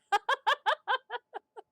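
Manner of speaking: high, loud peal of laughter; real high cackle. Real, not acted. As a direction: let out a big rolling laugh. Laughs after her remark.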